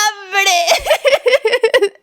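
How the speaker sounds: high teary laugh; real laughter; laughing through a whine. Real, not acted. Laughing at own story.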